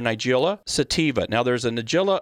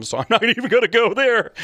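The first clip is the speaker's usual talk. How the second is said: laughing through the words, higher and louder; 9 semitones higher, 5 dB louder than usual. Real, not acted. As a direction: chuckle as you dodge the hard part. Laughing off hard names.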